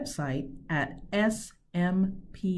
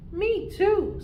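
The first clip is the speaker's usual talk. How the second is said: little bear cub; 14 semitones higher, 4 dB louder than usual. Acted. Cub Dash joins the apology.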